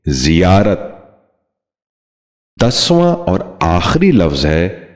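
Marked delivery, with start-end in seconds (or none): 0.1-0.8 زیارت starting with zi, "zi-yaarat"; correct زیارت. Shown as a demonstration. Teacher modelling for viewers, زیارت said as "ziyaarat". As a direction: put an i in the first syllable of زیارت. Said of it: "آپ بھی اس لفظ کو غور سے سنیے".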